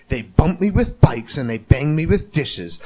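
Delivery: usual speaking voice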